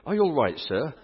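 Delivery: high-pitched, very British inquiry; lightly hammed-up British politeness; very British chap. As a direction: ask like a concerned, very British gentleman. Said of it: "very British chap said".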